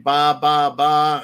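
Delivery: mock bleat, punched out like a sheep. Mocking politicians as sheep with a bleat. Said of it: "start sounding like sheep".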